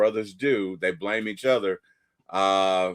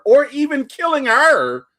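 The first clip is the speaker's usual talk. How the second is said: indignant rant; 9 semitones higher, 8 dB louder than usual. Real, not acted. Outraged about Odin's deeds.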